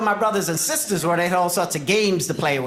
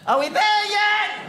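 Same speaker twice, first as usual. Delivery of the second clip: put-on high, slow, drawn-out kid whine; whiny kid; slower than usual. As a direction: stretch it out like a bored child. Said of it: "my job every five minutes to ask".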